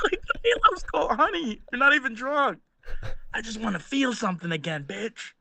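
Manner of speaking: bitter husband. Retold or imitated. Voicing a bitter drunk husband.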